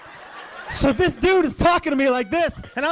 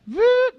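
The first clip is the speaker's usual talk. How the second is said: quick high-pitched vocal zip; imitated window noise; 12 semitones higher, 4 dB louder than usual. Driver cracking the car window, high imitated zip. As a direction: make the noise of a window briefly moving.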